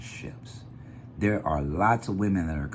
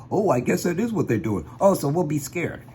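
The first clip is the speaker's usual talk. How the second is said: higher, dopey voice of someone swallowing it; mock gullible folks; 8 semitones higher than usual. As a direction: imitate the clueless crowd, mockingly. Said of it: "ignorant folks running around going".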